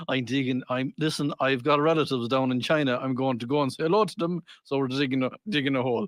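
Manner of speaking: low mock-drunk Irish voice; lightly played stubborn drunk; drunk digger. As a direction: explain doggedly as a tipsy Irishman. Acted drunk digger answering wife.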